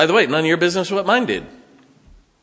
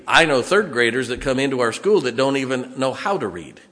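ordinary delivery

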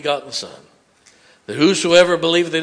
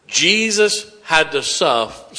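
usual speaking voice

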